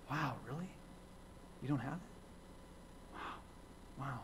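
a hushed, breathy, let-down wow, almost whispered; mock the unbelievers with a soft, sarcastic whispered wow; sarcastic disappointed wow. Pastor mocks the faithless hometown crowd with a sarcastic whispered wow. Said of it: "more sarcastic things going, kinda going".

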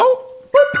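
very high, put-on customer question. Streamer voicing a customer at the till, very high put-on voice. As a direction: ask it in a squeaky stranger's voice.

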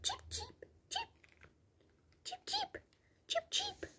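very high, whispered little cheeps; tiny bird whispering cheeps; whispered bird chirp. Pretend. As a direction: breathe the peeps like a far-off chick.

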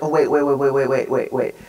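rapid stream of repeated waits, sinking in pitch; dawning realization. Retold or imitated. Youth worker recalling the bus, sudden realization. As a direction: rattle it off as it dawns on you.

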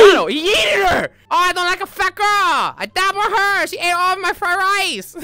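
real excitement at the throw; excited yelling. Real, not acted. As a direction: cheer it out.

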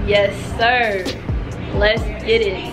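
ordinary talk

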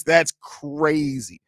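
slow, stretched-out disbelief; drawn out. Real, not acted. To chat after a joke, a slow drawn-out reaction. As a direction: let the words drag out, amused.

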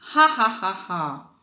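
mocking laugh. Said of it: "he kept on laughing".

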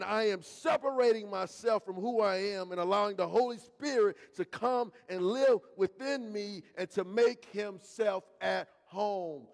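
ordinary talk, nothing marked

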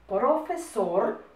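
PROFESOR very slowly stretched; slow dictation. Shown as a demonstration. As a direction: draw out each syllable of PROFESOR. Dictating words to write down.

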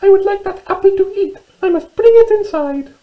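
very high, squeaky mouse voice; little mouse. Acted. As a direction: squeak it out like a tiny storybook mouse. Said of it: "said Little Mouse".

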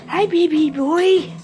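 normal talking voice

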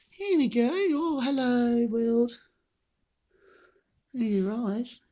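soft, high cooing; tender pet talk. Real, not acted. Owner greeting the pet's opening eyes, soft high cooing. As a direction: coo gently in a small high voice, drawing out the hello.

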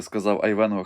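ordinary delivery

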